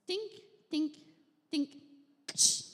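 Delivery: high plinking dink then a loud ksh crash; lamp clatter noise. Retold or imitated. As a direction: mimic a lamp bouncing down a cave. Imitated lamp falling and breaking.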